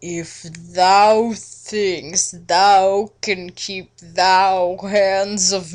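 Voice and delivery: slow, drawn-out smug archaic drawl; hammed-up smug vampire; smug Edward. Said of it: "he answered all smug".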